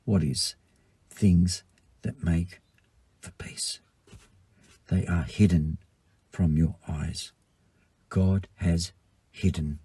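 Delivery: soft, low, hushed scripture reading; a quiet, grieving opening that fades into a whisper. Acted. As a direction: draw them in with a near whisper.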